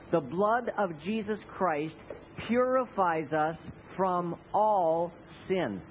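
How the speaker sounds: slow, measured scripture reading; slow recitation. Shown as a demonstration. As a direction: say each word slowly for them to repeat. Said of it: "Will you say that with me?".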